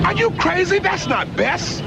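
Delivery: high-pitched, exasperated protest; indignant outburst. Acted. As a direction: protest in heated disbelief. Heated argument over mistaken identity.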